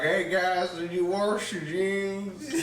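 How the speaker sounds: high-pitched imitation of dad's Southern accent. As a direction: say it like a Southern dad, R in "wash". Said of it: "my dad would be like".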